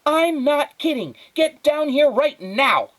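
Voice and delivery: high, loud, slow furious yell; angry shout. Acted. Mother ordering Flora downstairs, angry shout. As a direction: lay down the law at full volume.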